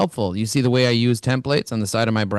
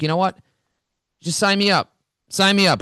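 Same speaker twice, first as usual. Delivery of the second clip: quoted client, pitched higher and eager; 7 semitones higher than usual. Retold or imitated. Client suddenly eager to sign.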